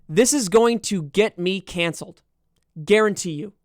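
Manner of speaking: ordinary talk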